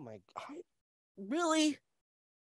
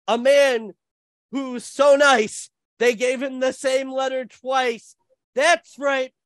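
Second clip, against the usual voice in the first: announcer intro; 5 semitones higher, slower than usual. Acted. Announcer-style guest introduction.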